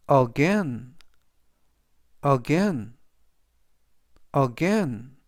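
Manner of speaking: "again" with the A after G stressed; light first syllable, stress the "gain" of "again"; stress on second syllable. Shown as a demonstration. Teacher models "again" for learners, stress on second syllable. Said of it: "G 뒤에 있는 A의 강세가 있습니다".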